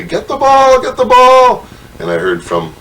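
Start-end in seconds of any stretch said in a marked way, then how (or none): 0.0-1.7 very high, loud mock crowd yell; imitated crowd yelling. Parents and kids at a ballgame yelling at the fielder, mock yell. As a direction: yell it high and urgent, imitating frantic spectators. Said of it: "started yelling at me".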